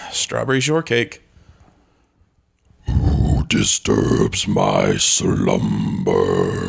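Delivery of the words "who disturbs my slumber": very low, very slow booming cave; grinding stone statue. Acted. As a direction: rumble it out like ancient stone. Plays the Cave of Wonders.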